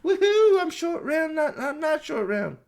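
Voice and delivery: put-on very high, whooping kid-sidekick parody; Not Short Round. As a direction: whoop in a silly falsetto. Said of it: "Short Round's like".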